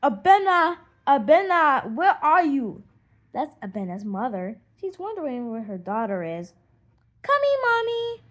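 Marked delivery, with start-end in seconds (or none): 0.0-2.8 loud call across the yard; a mother calling her child home; raised voice calling a name from afar. Acted. Mother calls for her daughter. 7.2-8.2 high, loud answering call; girl calling back to her mother. Acted.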